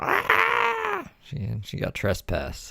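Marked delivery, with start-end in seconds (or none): 0.0-1.1 high retold scream, no words; retelling her scream at Dave; mock shriek. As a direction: let out a sudden high shriek. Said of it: "she was like".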